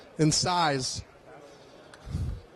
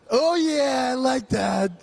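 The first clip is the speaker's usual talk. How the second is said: excited; 4 semitones higher, 3 dB louder, slower than usual. Real, not acted. Excited at weed sign.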